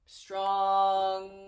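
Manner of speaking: STRONGEST stretched long, low and loud; drawn out slowly. Shown as a demonstration. Teacher adding EST to an adjective, drawn out slowly. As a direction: hold the first syllable of STRONGEST, then add the ending. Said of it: "go through them slowly".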